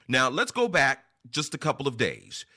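normal talking voice

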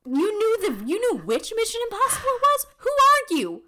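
high, loud astonished cry; amazed. Real, not acted. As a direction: shriek in disbelief. Amazed at trivia answer.

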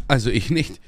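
ordinary talk, nothing marked

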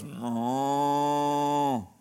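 drawn-out low mooing; mock cow moo. Preacher imitating a cow in the farmyard, mock moo. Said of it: "the cow walks up and goes".

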